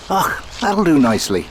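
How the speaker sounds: a hammed-up attempt at an Irish accent; jokey Irish accent. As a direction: put on your best Irish accent, badly. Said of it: "in my best Irish accent".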